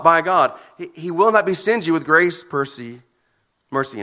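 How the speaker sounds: ordinary delivery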